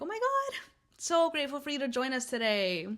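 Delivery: high, loud mock-gushing welcome; exaggerated petty sweetness; petty sarcasm. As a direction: greet the latecomer with fake, high-pitched gratitude. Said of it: "Like, real petty".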